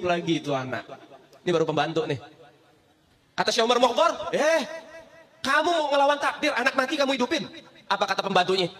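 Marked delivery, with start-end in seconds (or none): 4.3-7.5 higher, indignant; stern challenge. Acted. Saint rebukes the servant for defying fate, higher. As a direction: challenge her sharply.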